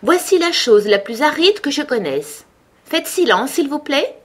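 high-pitched, self-important mouse. A narrator's reading. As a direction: address the assembly as someone who matters. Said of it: "reprit la souris d'un air important".

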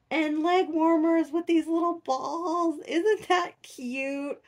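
high, excited gushing; gushing over cute outfit. Real, not acted. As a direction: coo over the tiny outfit like it's the cutest thing ever.

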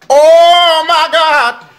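long loud high shriek; drawn-out shrieking oh my God; shocked scream. Retold or imitated. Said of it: "makes me go".

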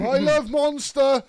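loud drawn-out shout; loud, slow shout. Acted. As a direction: belt it out slowly. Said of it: "Unnecessary volume".

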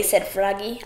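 plain reading voice

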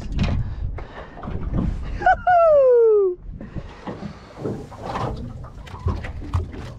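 airy giggle high up; breathless high laugh. Real, not acted. Angler laughing over her ripper fish, breathless giggle.

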